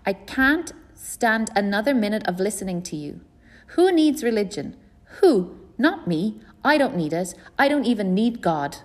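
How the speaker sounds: put-on a girl losing it, yelling at her family; hollering. A narrator's reading. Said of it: "I hollered".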